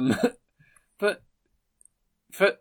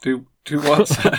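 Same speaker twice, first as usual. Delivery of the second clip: puzzled waiter; 3 semitones higher, 8 dB louder than usual. Acted. Waiter role, confused.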